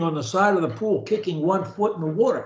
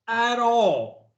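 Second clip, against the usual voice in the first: with energy; 3 semitones higher than usual. Shown as a demonstration. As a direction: sell it with energy and conviction. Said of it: "the way I do, with energy".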